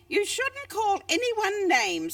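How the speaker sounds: lightly voiced lizard reproving; gentle scolding. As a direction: tick him off kindly.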